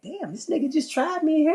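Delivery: high-pitched stunned inner voice; shocked disbelief. Retold or imitated. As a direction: squeak it out as if you can't believe the challenge. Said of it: "I was in shock".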